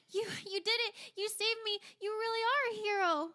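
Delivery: very high, loud, gushing admirer; excited, high-pitched squeal of praise; Hilda. Acted. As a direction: gush at your rescuer in delight. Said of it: "throws her arms around you".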